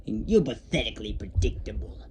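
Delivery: slow, partly whispered Goblin menace; slow sinister taunt from the Goblin; villain voice. Acted. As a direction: draw out the offer like a creepy villain. Goblin taunts them with an offer.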